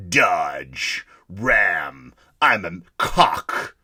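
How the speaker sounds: very low, slow macho announcer parody; gritty truck-ad voice. Acted. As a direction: growl it like a hammed-up truck commercial. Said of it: "My gritty voice".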